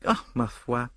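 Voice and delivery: light French nonchalance; airy, carefree French detective; Poirot, airy. A narrator's reading. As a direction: toss it off breezily in French. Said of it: "said Poirot airily".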